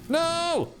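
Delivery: sharp high no; sudden high no; high dismayed cry. Real, not acted. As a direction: yelp it in dismay. Dying in game.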